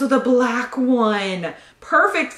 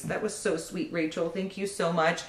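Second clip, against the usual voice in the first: touched; 3 semitones lower, 9 dB quieter than usual. Real, not acted. Touched by a gift note.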